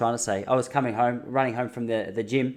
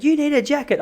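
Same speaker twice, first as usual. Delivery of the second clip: much higher mock lady voice; 12 semitones higher than usual. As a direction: squeak it out like a fussing flight attendant. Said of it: "she's like".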